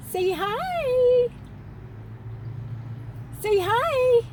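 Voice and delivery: very high, singsong squeak; high-pitched "say hi" on someone's behalf; high baby-talk. Pretend. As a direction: coax a little one in a tiny, high voice. Coaxes little girl to greet viewers.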